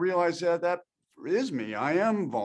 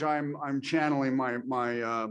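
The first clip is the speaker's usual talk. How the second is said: ordinary delivery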